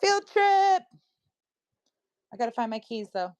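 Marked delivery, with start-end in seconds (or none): 0.0-0.8 high, loud, stretched-out call; excited announcement. Real, not acted. Vlogger announcing an outing to viewers, excited. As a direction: sing it out high and loud, thrilled to be off.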